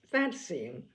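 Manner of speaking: ordinary delivery, nothing marked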